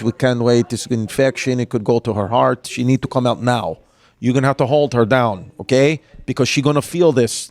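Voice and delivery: put-on low Russian-accented voice. Dentist telling a father the tooth must come out, Russian accent. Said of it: "this Russian woman".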